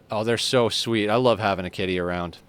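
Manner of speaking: ordinary talk, nothing marked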